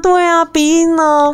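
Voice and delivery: high, slow, nasal tone; nasal sing-song. Shown as a demonstration. Host shows the nasal voice to the co-host. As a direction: drag it through a stuffy nose.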